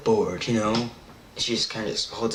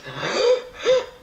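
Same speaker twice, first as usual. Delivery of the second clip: high, exaggerated gasps for air; mock dry-mouth gasp; fish-out-of-water gasping. Mouth completely dry like a fish on land, high comic gasping.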